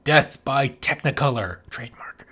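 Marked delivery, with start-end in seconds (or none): 1.7-2.3 hushed whispered aside; stage whisper. Acted. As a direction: whisper it like a secret joke. Joke title aside, whispered.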